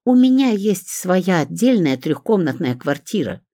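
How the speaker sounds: high, indignant mild mock screech; shrieking. A narrator's reading. Olga insisting on her own flat, mildly shrieking. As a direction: raise the pitch in squawking protest. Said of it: "заверещала Ольга".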